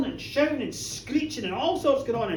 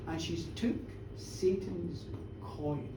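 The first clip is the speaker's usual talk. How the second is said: slow, low, hushed and ominous; grave accusation; 6 semitones lower, 11 dB quieter, slower than usual. A narrator's reading. Village woman telling minister of Satan's coin, hushed and ominous. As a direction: deliver the dark charge slowly and softly.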